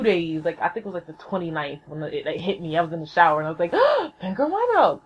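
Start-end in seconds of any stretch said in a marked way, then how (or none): 4.2-5.0 high gasp of realization; gasp, then cry it out high as the idea hits; thrilled lightbulb moment, acted out; sudden eureka. Retells her sudden idea.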